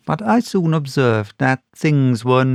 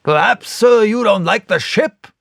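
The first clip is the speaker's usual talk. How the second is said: sharp, higher, louder retort; angry squire; 8 semitones higher, 4 dB louder than usual. A narrator's reading. Angry squire snapping back at the captain. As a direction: bristle with offence. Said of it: "very angry".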